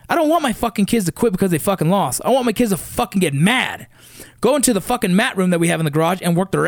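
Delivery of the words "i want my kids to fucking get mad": ordinary talk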